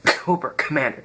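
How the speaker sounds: name slipped out through a chuckle; laughing. Pretend. Toy reviewer teasing the lookalike, through a laugh. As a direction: giggle the name out. Laughing at his own joke.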